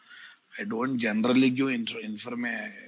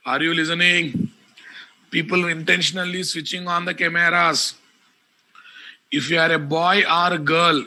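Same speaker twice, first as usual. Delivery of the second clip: raised, loud, scolding teacher; angry scolding; 3 semitones higher, 4 dB louder than usual. Real, not acted. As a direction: tell the class off loudly. Teacher scolding students about cameras.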